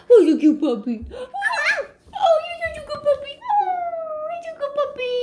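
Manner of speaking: high sing-song praise for a dog; squeaky praising a dog. Acted. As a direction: coo over a good puppy. Parent praises child as puppy.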